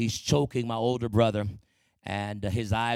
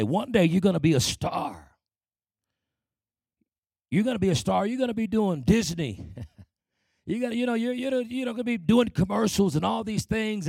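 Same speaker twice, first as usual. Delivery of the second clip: higher, bright mock-teacher praise, climbing; encouraging teachers; 7 semitones higher than usual. Teachers telling the boy he'll be a star, higher, gushing. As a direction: gush like a teacher promising stardom. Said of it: "all my teachers would say".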